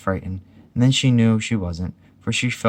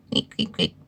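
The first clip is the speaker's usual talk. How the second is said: tiny short squeaks; squeaky animal noise; 4 semitones lower, 3 dB quieter than usual. A narrator's reading. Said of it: "Piglet made a squeaky Roo noise".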